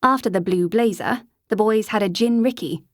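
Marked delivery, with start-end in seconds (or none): none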